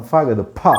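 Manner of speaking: "ఫా" as a puffed p-ha, not fa; aspirated p. Shown as a demonstration. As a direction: release a burst of air on "ఫా". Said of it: "aspirated పా".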